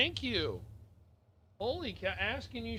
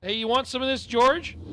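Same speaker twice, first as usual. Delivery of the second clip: louder, calling across the room; 4 semitones higher, 8 dB louder than usual. Real, not acted. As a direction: shout the offer over to George.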